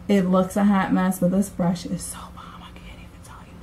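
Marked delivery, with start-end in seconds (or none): 1.8-3.5 soft whisper while applying mascara. Real, not acted. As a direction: whisper it, focused on the lashes. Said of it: "or you have to whisper at least".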